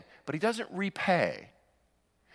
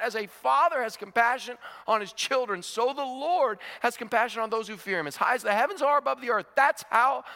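raised, higher preaching voice, rushing the lines; 9 semitones higher than usual. Acted. Preacher urges listeners to read the Psalm, fervent preaching. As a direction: preach it to the congregation with rising fervour.

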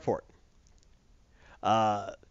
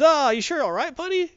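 mock-friendly buddy, much higher; chummy Kraven; 12 semitones higher, 3 dB louder than usual. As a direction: Kraven checking on his pal.

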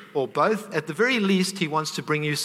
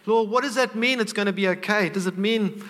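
anxious questioning; 6 semitones higher than usual. Retold or imitated. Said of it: "And I said".

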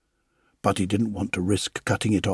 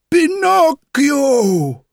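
joyful calling; 10 dB louder, much slower than usual. A narrator's reading. Parents greet him joyfully.

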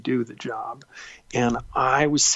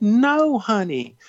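high, loud Southern "no", easing down; sweet Southern dismissal, starting high; Junie's Southern accent; 9 semitones higher, 6 dB louder, much slower than usual. Retold or imitated. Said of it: "she has this wonderful Southern accent".

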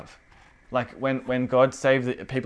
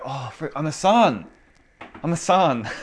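higher, re-enacted burst of discovery; excited realisation; 4 semitones higher, 4 dB louder than usual. Believer realising he is a son, excited. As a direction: let the truth land, raised and emphatic.

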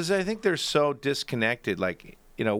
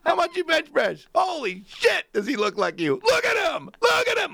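gawk and yell at him; hammed-up teasing yell; mock jeering shout. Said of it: "he'd been doing it for years".

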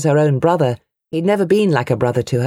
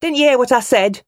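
a much higher, impatient woman's voice; sharp mother; 10 semitones higher, 3 dB louder than usual. A narrator's reading. Mother snapping back at her brother, sharp woman's voice. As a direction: demand an answer.

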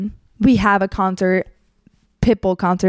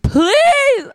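high, drawn-out whiny plea; desperate begging; 3 dB louder than usual. Retold or imitated. Guy collapsing in her arms, whiny pleading. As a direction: imitate a collapsing, whiny beg.

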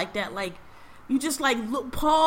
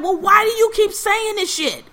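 raised-pitch exasperated questioning; frustrated mom; 8 semitones higher, 4 dB louder than usual. Retold or imitated. Mom questioning her son's story, frustrated. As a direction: imitate a mom losing patience. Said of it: "was getting frustrated too".

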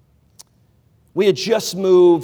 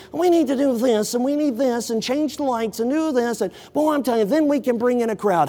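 hushed mock church planner; theatrical voice; 6 semitones higher than usual. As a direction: ham it up for effect. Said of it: "just for theatrical sake".

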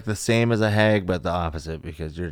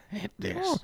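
put-on old lady voice; 7 dB quieter than usual. Joining the crone bit.

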